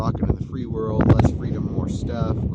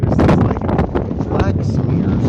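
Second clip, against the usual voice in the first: loud voice raised against the wind; shouting over wind; 9 dB louder than usual. Real, not acted. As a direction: shout so you're heard over the gusts. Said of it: "If you can even hear me over the wind".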